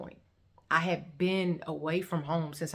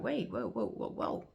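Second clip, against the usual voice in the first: lower-pitched stunned stammer; taken aback; 7 semitones lower, 3 dB quieter than usual. Retold or imitated. Reacting to his barrage of questions, taken aback. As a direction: halt the questioning, low and thrown. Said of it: "I'm really taken aback".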